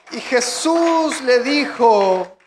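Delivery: loud, high preaching of Scripture; impassioned pulpit reading of John twenty; fiery preaching. Acted. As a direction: preach the Gospel passage out at full force. Reads Scripture at full preaching force.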